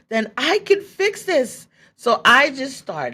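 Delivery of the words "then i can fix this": high, bright burst of realization; sudden elation. Retold or imitated. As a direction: jump up in pitch as the solution hits. Retells sudden hopeful realization.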